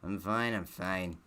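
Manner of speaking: normal talking voice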